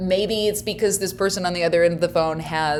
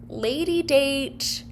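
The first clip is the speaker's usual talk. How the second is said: higher, slow lady date; caller quote; 8 semitones higher, much slower than usual. Retold or imitated. Quoting the caller's plan, slowly and higher. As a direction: stretch out lady date, pitched up. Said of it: "she says".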